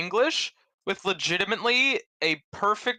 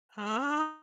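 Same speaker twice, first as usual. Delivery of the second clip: confused huh; 7 semitones higher, 6 dB quieter than usual. Retold or imitated. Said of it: "the accent made me go".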